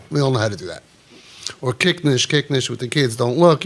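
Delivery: ordinary talk, nothing marked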